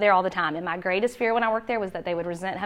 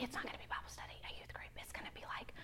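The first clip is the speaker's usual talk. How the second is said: hushed confidential whisper; whispered aside; 25 dB quieter, much faster than usual. Retold or imitated. Mom privately admitting what youth group is, whispered aside. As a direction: whisper the admission under your breath.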